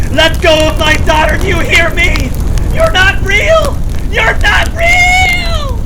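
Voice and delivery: high, loud scream building to a shriek; screaming mother. Acted. Mother to whatever is inside her daughter, screaming. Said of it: "is your mother screaming".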